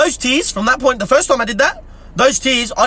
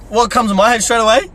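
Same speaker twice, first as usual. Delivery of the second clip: ordinary talk, nothing marked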